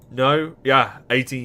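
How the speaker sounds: jolted disbelief at the number; surprised. Real, not acted. Streamer reacting to the game's age, surprised.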